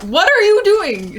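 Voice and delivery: high, loud mock outrage; exasperated yell, voice leaping way up; Lando. Lando after Han crashes it again, mock outraged yell. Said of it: "it's Lando being incredibly upset".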